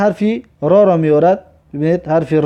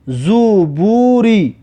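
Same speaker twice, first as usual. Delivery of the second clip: slow, syllables joined smoothly; drawn-out model reading; much slower than usual. Shown as a demonstration. Teacher repeats a word for learners, slow and drawn out. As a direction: stretch "زوبوری" in one slow run.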